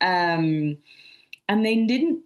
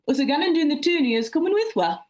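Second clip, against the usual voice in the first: broad Mackem accent, higher and punchy; Sunderland dialect; 8 semitones higher, faster than usual. Shown as a demonstration. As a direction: read it out in a thick Sunderland accent. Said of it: "English spoken in my hometown".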